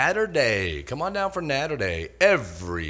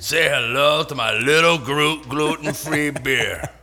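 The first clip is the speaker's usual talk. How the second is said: loud hammed-up gangster voice breaking into a laugh; mock Scarface; 4 semitones higher, 7 dB louder than usual. Host twisting the Scarface line into a beer ad, loud and laughing. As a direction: ham up the movie line, then laugh.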